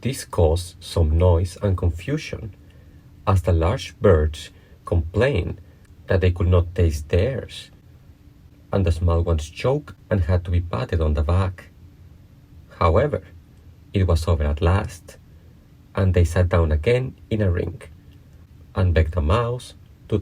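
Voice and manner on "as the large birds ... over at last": plain reading voice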